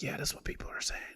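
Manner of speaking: very soft, breath-only whisper; whispering gossip. Retold or imitated. Customer insists on the celebrity rumor, whispering. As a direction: confide it in secret. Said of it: "Why are we whispering?".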